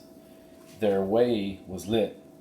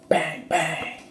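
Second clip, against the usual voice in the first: mock gunshots, higher; gunshot imitation; 8 semitones higher than usual. Narrator imitating the rustlers' gunfire, mock bangs. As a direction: pop out the shots.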